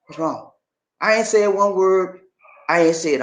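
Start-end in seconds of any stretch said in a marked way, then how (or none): 0.1-0.5 gentle concern. Retold or imitated. Voicing his concerned wife's question.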